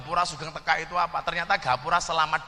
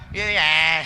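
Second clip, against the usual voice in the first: lightly Pantura dialect; 2 semitones lower, 4 dB louder than usual. Retold or imitated. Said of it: "Ngomongnya gimana?".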